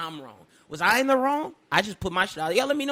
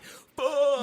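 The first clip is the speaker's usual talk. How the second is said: exasperated fuck; 4 semitones higher than usual. Shown as a demonstration. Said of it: "I'm saying it like".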